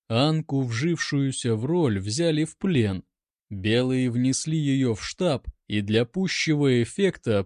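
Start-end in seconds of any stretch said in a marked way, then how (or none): none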